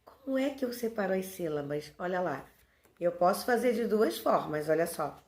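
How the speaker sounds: ordinary talk, nothing marked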